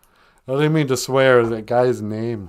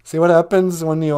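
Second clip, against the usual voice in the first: ordinary delivery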